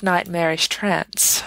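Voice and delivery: plain reading voice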